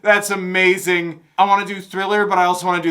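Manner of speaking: high, loud thrilled reaction; delighted excitement. Real, not acted. Fan reacting to the choreography, delighted. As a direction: gush loudly, breaking out of a laugh.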